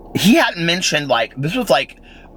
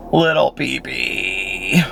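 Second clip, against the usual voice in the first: drawn out slowly, fondly; slow, tender; much slower than usual. Real, not acted. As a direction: linger on "Little Pee-Pee" like cooing to a pet. Fond aside about the old dog.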